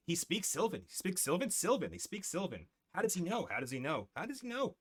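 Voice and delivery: high, excited creature chatter. Acted. Hidden sprites marvelling that Franco knows their tongue, high squeaky chatter. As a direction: chirp it up high, amazed.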